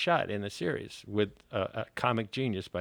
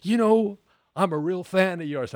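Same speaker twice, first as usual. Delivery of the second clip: imitating Chris Farley; 8 semitones higher, 8 dB louder than usual. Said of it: "he said".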